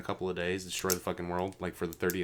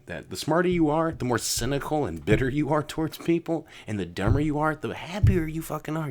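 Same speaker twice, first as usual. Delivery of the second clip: rising, louder rant; heated rant; 8 semitones higher, 6 dB louder than usual. Real, not acted. Ranting to cohost about smart people, higher and louder. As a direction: build it up, voice climbing high.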